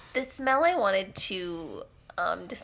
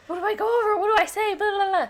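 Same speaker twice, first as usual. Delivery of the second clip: high squeaky mimicry; high mocking voice; 12 semitones higher, 5 dB louder, much faster than usual. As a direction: mimic them, pitched way up. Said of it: "they're like".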